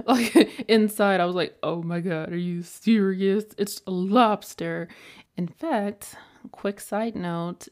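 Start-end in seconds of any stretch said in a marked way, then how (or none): 1.6-4.9 louder, stunned disbelief; the inner "are you serious" shock. Retold or imitated. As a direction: play up your own inner outrage. Her inner shocked reaction.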